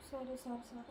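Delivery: soft, mumbled resigned okays; sulky giving in. Acted. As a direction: give in under your breath. Daughter gives in to scolding. (తిట్లకు తలొగ్గిన కూతురు, మెల్లగా గొణుగుతూ)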